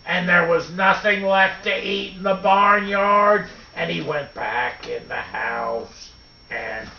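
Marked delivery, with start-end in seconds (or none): none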